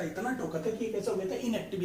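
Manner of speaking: ordinary talk, nothing marked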